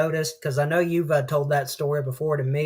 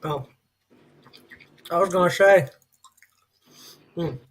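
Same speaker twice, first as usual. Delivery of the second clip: eating candy while talking; mouth full. Real, not acted. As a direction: talk around a piece of candy. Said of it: "sorry, I'm eating a little bit of candy".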